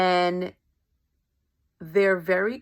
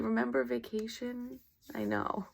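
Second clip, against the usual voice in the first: ordinary delivery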